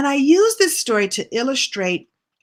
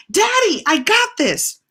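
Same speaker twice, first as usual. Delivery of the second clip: high and a bit louder, calling to daddy; proud child calling back; 12 semitones higher, 4 dB louder than usual. Retold or imitated. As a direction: shout it back over your shoulder, thrilled. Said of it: "turning around and saying".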